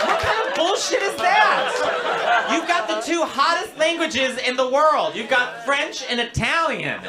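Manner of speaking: hammed-up shock; outraged at English at home; mock outrage. Real, not acted. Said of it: "outraged at speaking English".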